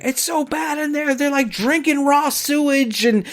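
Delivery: high-pitched mock politician; whiny high mock of the congresswoman; mocking impression. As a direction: parody her, voice up high. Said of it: "and she's like".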